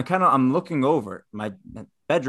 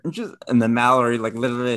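usual speaking voice

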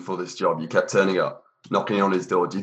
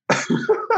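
sudden breathy laugh; real laugh; burst of laughter. Real, not acted. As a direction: crack up at the joke. Host laughs at interview joke.

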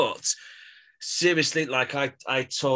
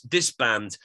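usual speaking voice

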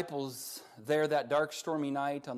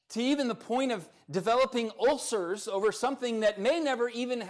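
high, loud, rising sermon voice; full-force preaching; 9 semitones higher, 6 dB louder than usual. Acted. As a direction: build it up like a pulpit climax. Pastor preaching, rising intensity.

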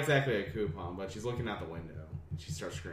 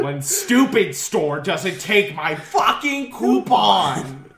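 furious slow yell with laughter; angry shout; 8 semitones higher, 13 dB louder, slower than usual. Pretend. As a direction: yell it like an outraged customer. Acting out angry mom gif.

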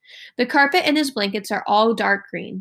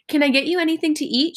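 quick, higher, anxious offer; nervous; 6 semitones higher, faster than usual. A narrator's reading. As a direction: rush it out, nervous host. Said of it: "sounding nervous".

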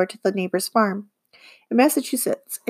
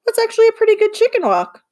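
much higher, louder and fast, delighted; excited praise; 13 semitones higher, 5 dB louder, faster than usual. Acted. Livy suddenly impressed by Bob's chicken walk, excited. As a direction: burst out with surprised delight.

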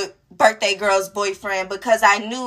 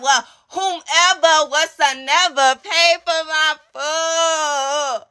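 very slow, drawn-out words, pitched up; mock grandiose; 5 semitones higher, much slower than usual. Reacting to who paid for dinner, drawn-out mock grandness. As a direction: stretch every word out theatrically. Acts out awkward grand reply.

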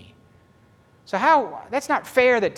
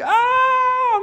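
joyful oh; 6 dB louder than usual. Retold or imitated. Joyful exclamation.

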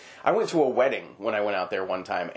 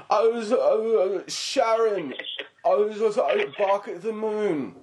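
higher, slow, slurred rocker mumble; 9 semitones higher, slower than usual. Retold or imitated. Friend mocks how hard a rock star is to follow, with a slow slurred mumble. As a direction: mumble it half-lost like a dazed rock star. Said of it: "listening to [name]".

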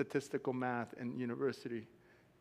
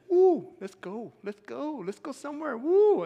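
put-on high, drawn-out whoops of young adventure; thrill-seeking kid, pitch climbing to a loud woo; excited whooping; 8 semitones higher, 8 dB louder than usual. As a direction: whoop it up, eager to go. Retelling his fearless youthful excitement.

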